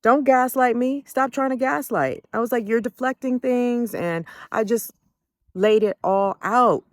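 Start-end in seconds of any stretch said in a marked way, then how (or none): 0.0-3.9 heated retort. Retold or imitated. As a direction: push back hard against gaslighting. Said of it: "So I'm like".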